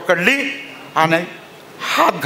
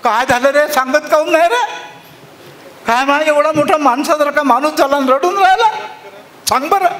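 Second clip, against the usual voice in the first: put-on old woman calling out; 10 semitones higher than usual. Old mother scolds her grown son for crying. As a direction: call out, half worried half scolding. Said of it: "आणि तिथून ओरडली".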